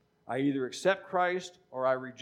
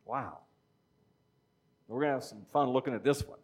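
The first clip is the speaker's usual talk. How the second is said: usual speaking voice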